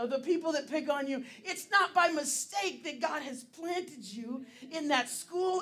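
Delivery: preaching. Acted. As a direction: preach it to the room with full force.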